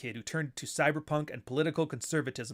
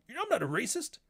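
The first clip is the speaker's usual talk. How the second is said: 1 mocking conservative; 8 semitones higher than usual. Mocking hurt conservative critics.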